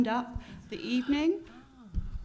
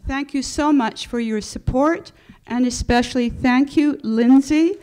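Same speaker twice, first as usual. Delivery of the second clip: loud; 9 dB louder than usual. Real, not acted. Thanking panelists into microphone.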